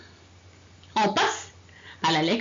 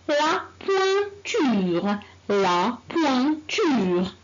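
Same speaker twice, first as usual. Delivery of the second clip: La pointure, drawn out with a slow, clear poin-ture; very slow, careful model word; slow model reading; much slower than usual. Shown as a demonstration. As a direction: model it slowly for the class. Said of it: "on commence la lecture".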